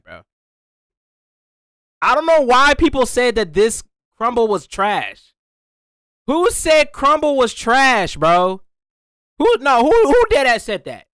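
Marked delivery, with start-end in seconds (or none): 2.0-11.1 loud, incredulous outrage; indignant. Acted. Reviewer demanding who called the cookie trash, loudly indignant.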